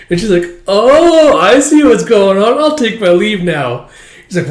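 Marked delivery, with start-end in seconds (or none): 0.7-3.9 hammed-up high, loud "oh" easing down; exaggerated knowing tone. Character assuming a romance and leaving, exaggerated knowing tone. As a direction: draw out the exit line, mock understanding.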